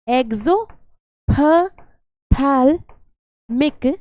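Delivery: slow, broken into separate syllables. Real, not acted. Teacher sounding out exophthalmic for students, syllable by syllable. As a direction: sound out the long term piece by piece. Spelling out a hard term.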